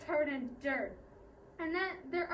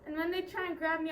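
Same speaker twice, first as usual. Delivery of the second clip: plain reading voice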